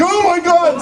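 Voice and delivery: high, overwhelmed gasp of words; hammed-up high-pitched astonishment; starstruck fan. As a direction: play the fan losing it. Fan's retold reaction to reveal.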